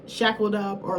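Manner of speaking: ordinary talk, nothing marked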